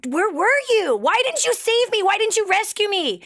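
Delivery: hammed-up outrage of a man who drowned; drowned man complaining to God, mock outrage; mock indignant complaint.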